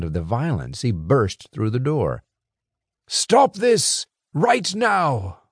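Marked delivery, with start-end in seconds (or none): 3.1-5.4 high, slow, commanding growl. A narrator's reading. Gregor bursts in and stops the brawl, growling. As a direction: stop the fight with one order. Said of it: "Gregor growled".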